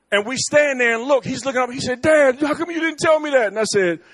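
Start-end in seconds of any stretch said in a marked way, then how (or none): 2.0-3.5 high, loud complaining shout; complaining son. Retold or imitated. Son at the bottom complaining to dad, loud protest.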